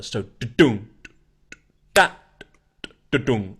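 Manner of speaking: da-dum with the accent on dum; accented beat. Shown as a demonstration. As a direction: sing the drum accent. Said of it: "That's how the drum does it".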